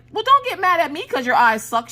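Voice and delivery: put-on very high-pitched kid retort; defiant little sister. Daughter to annoyed brother, defiant little sister. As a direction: mimic a sassy child talking back.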